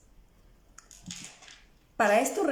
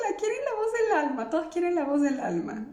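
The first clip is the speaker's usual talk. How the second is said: much higher, fast, laughing; 7 semitones higher, faster than usual. Real, not acted. Reading that all the viewers want the soul's voice, laughing. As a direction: giggle the words out.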